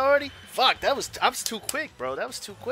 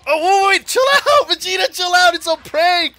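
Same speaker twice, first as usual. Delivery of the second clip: loud, much higher yelp; mock panicked pleading; 9 semitones higher, 8 dB louder than usual. Player begging Vegeta to chill out, mock panicked shout.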